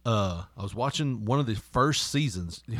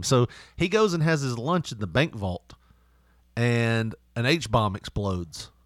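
ordinary talk, nothing marked

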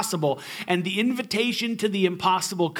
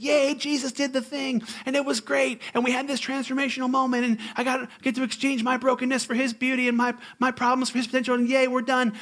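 higher, bubbly sarcastic gush; a mock chirpy believer; mock cheerful; 7 semitones higher than usual. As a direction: parody shallow enthusiasm.

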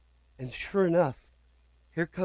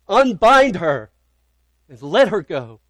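proclaimed command; 4 semitones higher, 9 dB louder than usual. Acted. Preacher voicing God's command to death, booming proclamation. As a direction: declare it over death with full authority.

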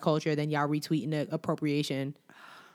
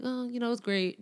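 soft, higher-pitched offhand brag; not humble; 7 semitones higher, 5 dB quieter than usual. Retold or imitated. Host mimicking the girl's answer to TMZ, blasé and unhumble. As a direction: toss it off as if the nomination is nothing. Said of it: "Not humble".

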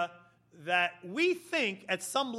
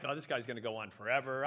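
muttered aside; 7 semitones lower, 6 dB quieter, faster than usual. Acted. Knight's private aside about the boaster.

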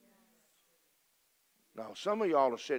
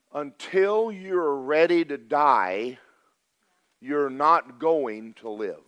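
louder and slow, every word weighted; emphatic slow declaration; 7 dB louder, much slower than usual. Real, not acted. Preacher urging the congregation, loud and deliberate. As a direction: drive it home slowly and hard. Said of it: "I want you to think about this".

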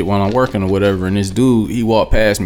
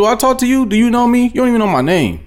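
much higher, heated telling-off; angry retort; 11 semitones higher than usual. Retold or imitated. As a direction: tell the stranger off, voice high and sharp.

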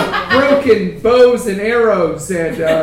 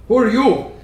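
minotaur voice. Acted. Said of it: "the Minotaur says".

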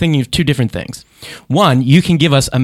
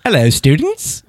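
put-on British teacher voice; British accent; slower than usual. Host playing his guest as a schoolteacher, British accent. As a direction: greet the class in a hammed-up British accent. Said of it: "Josh and I do our best British accent".

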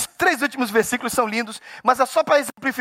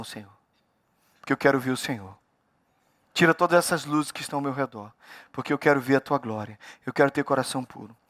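low, soft praying voice; subdued plea to God; quiet prayer; 8 semitones lower, 5 dB quieter than usual. Real, not acted. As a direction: pray it gently and low, with pauses. Spoken prayer after singing.

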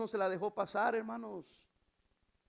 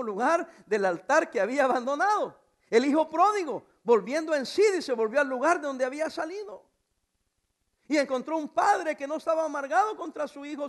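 loud, high-pitched pulpit proclamation; full-force preaching, stretched key words; 5 semitones higher, 10 dB louder than usual. Real, not acted. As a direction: proclaim it to the whole church.

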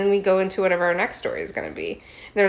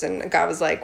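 ordinary talk, nothing marked

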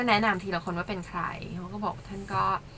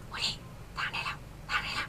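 imitated whisper; 13 dB quieter than usual. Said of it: "ไปสะกิดวูดดี้จากข้างหลัง". (กระซิบช้าๆ เลียนแบบ)

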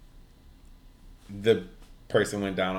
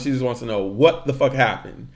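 ordinary delivery

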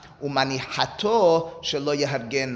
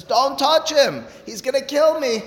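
frightened plea; 10 semitones higher, 5 dB louder than usual. Acted. Victim pleading in retold case.

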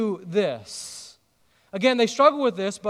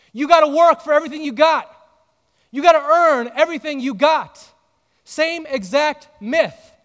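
higher and louder preacher's build; preaching at full force; 5 semitones higher, 7 dB louder than usual. Real, not acted. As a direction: drive home the myth to the congregation.

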